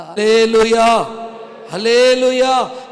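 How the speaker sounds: loud, drawn-out hallelujah call; slow, booming hallelujah; preacher's hallelujah. Acted. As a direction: rouse the congregation.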